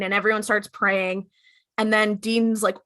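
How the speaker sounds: ordinary delivery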